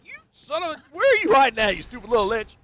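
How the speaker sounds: high, loud mock rage at the lich; frustrated yelling. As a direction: shout it way up in your voice, fed up. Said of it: "And I'm like".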